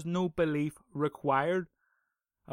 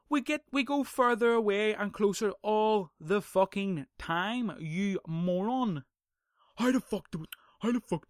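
higher, louder and slower, spitting out the scorn; exasperated insult; 5 semitones higher, 3 dB louder than usual. Real, not acted. Scolding an imagined arguer as a moron, exasperated and louder. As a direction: lean on it like scolding an idiot.

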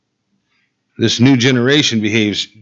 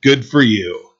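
mocking; 7 semitones higher than usual. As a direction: quote it as a taunt, dropping away on the last phrase. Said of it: "[name] actually says it mockingly".

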